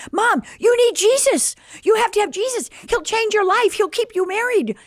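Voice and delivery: high, loud eager pleading; urgent plea. Retold or imitated. Daughter urging divorcing mom to find Jesus, eager. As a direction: press it on her mother earnestly.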